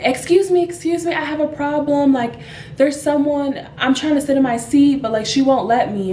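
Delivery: retold plea for attention, easing down toward the end; flustered complaint. Gentleman complaining about his taken seat, flustered.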